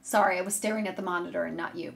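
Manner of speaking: normal talking voice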